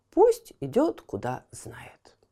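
usual speaking voice

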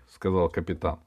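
plain reading voice

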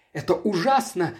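usual speaking voice

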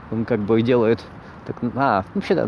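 ordinary talk, nothing marked

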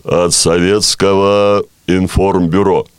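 slow, deep solemn state announcer; Levitan announcer voice. Shown as a demonstration. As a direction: boom it out like Soviet radio. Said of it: "я сразу понял, что имеется в виду".

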